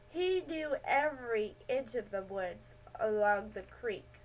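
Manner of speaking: usual speaking voice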